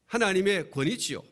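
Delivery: ordinary talk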